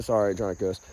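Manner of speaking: normal talking voice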